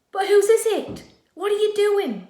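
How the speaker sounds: high, loud amazed question; bursting with wonder; excited puzzled girl. Acted. Katie confused amazed.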